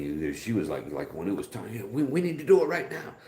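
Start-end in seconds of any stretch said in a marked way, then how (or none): 1.9-3.1 high-pitched, pressing mock female voice; urgent demand. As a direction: imitate a partner demanding it right away. Acting partner demanding it now.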